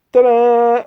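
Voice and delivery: high, loud and drawn out slowly; mock ta-da, stretched long; mock fanfare. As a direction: announce it like a showman's big reveal.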